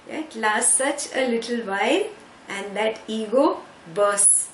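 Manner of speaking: ordinary delivery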